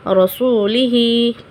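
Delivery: रसूलिही drawn out slowly, the long ही held; slow recitation. Shown as a demonstration. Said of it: "खड़ी ज़ेर की मिसाल".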